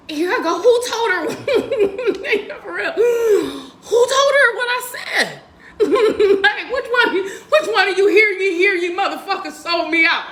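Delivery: high, loud words through laughter; real laughing disbelief; laughing while talking. Real, not acted. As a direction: speak while you can't stop laughing. Laughing through retelling betrayal.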